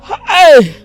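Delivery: sudden sharp shout. Acted. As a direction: call out to stop her. Sudden call out in scene.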